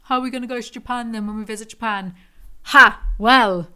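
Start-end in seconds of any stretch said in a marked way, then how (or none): 2.7-3.0 a single sharp, loud "Ha!"; loud scoffing laugh. Real, not acted. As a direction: bark out a mocking laugh. Scoffing at being asked about Japan.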